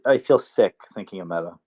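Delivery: ordinary delivery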